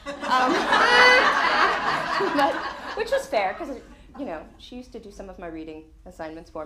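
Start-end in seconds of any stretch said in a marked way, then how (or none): 0.6-2.3 loud high burst of real laughter; big laugh. Real, not acted. Reacting to the friend's joke, a loud real laugh. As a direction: crack up out loud.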